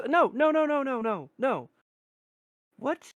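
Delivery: high, loud, rapid flustered no's; flustered refusal. Acted. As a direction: wave them off in a panic. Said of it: "just hand waving, just goes".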